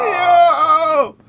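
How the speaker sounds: high, loud, drawn-out yo; astonished shout. Real, not acted. Friend reacting to the head coach joke, astonished shout. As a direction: cry out long and high in disbelief.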